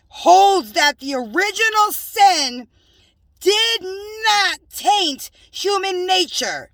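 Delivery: slow, loud, high reading; reading a heresy definition aloud in disgust; indignant reading. Real, not acted. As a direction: spell out the outrage.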